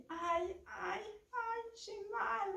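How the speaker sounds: high, soft drawn-out moan. Acted. Mother fish lies in the hut, moaning. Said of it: "elle gémit".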